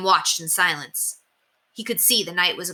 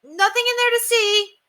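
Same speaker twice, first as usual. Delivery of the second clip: much higher, loud and hurried deflection; loud, to cover up; 3 dB louder than usual. Acted. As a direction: say it loud so the hidden knight hears. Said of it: "William said loudly".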